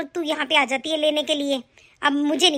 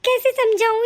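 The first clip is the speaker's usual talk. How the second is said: put-on higher, grieving old mother talking to herself; a hint of a hurt mother; mother grieves that her daughter left empty-handed; lamenting mother; 6 semitones higher than usual.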